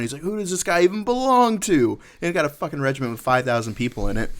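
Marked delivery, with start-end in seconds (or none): 0.2-2.0 freaking out. Retold or imitated. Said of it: "Sergeant Major is freaking out".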